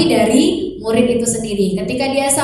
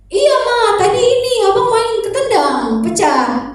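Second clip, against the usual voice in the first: put-on much higher little boy confessing; child's voice; 10 semitones higher than usual. Son tells mother he broke the vase, high child voice. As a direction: play the small son owning up.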